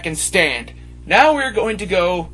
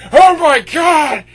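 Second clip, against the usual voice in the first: slow, furious shout, much higher; angry fan shout; 6 semitones higher, 7 dB louder, slower than usual. Retold or imitated. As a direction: yell it like an outraged fan. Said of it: "send me hate mail saying".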